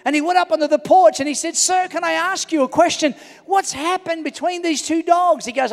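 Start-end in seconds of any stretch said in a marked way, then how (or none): 1.6-5.4 mildly acted, high-pitched concerned question. Passerby asking the owner about his dogs, raised concerned questioning.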